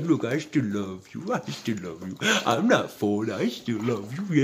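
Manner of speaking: soft, lightly voiced dog talking to owner; a light pet voice, gentle and low-key; voicing the dog. Speaking for the dog comforting her owner, soft pet voice. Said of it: "She's like".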